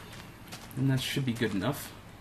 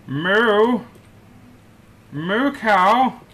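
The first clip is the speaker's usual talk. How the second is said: loud, high call across the field; calling cows; 7 semitones higher, 8 dB louder, much slower than usual. Real, not acted. Player calling the lost cow, high and loud. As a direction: holler out for the cow.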